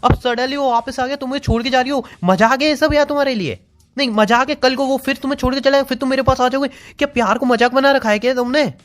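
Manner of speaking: high, hurt and heated pleading argument; heated hurt protest. Real, not acted. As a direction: argue back, wounded and worked up. Heated argument with leaving girlfriend.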